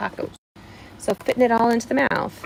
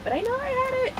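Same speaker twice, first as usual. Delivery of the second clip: a stifled excited squeal; a held-in scream of relief; inner scream; faster than usual. Real, not acted. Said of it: "I'm gonna scream in my head".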